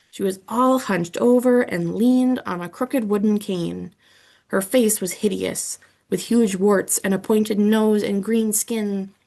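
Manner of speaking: plain reading voice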